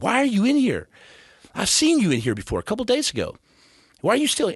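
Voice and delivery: a doctor demands why the patient is still there; insistent doctor. Retold or imitated. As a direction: demand an answer, alarmed. Doctor insisting, repeated question.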